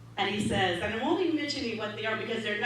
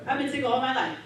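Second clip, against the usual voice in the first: ordinary talk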